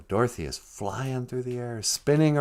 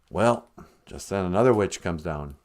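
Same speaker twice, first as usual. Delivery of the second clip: ordinary delivery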